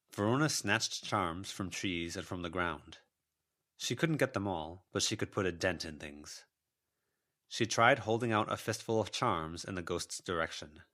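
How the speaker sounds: usual speaking voice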